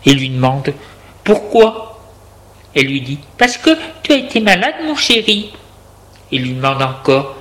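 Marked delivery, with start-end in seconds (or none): 1.2-2.0 higher-pitched voiced question; questioning patient. A narrator's reading. Sick man asks why. 3.4-5.5 quick, high, coaxing character voice; caring partner. A narrator's reading. Woman soothes her sick darling.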